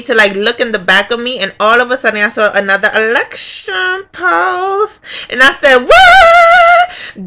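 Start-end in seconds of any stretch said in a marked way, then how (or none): 5.9-7.2 high, loud, long-held squeal; amazed. Retold or imitated. Voter spotting another polling place, amazed. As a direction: sing out the shock.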